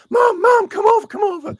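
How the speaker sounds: very high, loud excited call; fan calling mom. Retold or imitated. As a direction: shout for mom in a squeaky put-on voice. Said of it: "so then later he's like".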